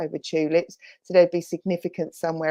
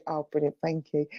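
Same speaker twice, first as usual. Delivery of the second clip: ordinary talk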